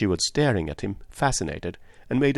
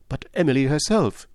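higher, unfinished protest; breaking off; 6 semitones higher, 4 dB louder than usual. A narrator's reading. Woman cannot finish her objection, breaking off. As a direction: stop before finishing the thought. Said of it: "she broke off".

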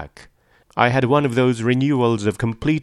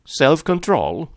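slow, high, disbelieving echo; drawn-out disbelief, pitched up; incredulous; 6 semitones higher, 3 dB louder, slower than usual. A narrator's reading. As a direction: throw it back as an incredulous question. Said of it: "repeated Tom incredulously".

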